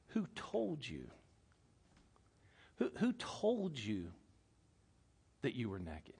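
slow, hushed questioning; God questioning. Acted. God questioning Adam in the garden, softly and slowly. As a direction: ask it softly and slowly, with long pauses. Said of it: "this is what God says".

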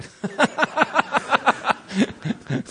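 a short burst of real laughter; laughing. Real, not acted. Preacher laughing at the C S Lewis story, amused. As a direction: laugh at the punchline.

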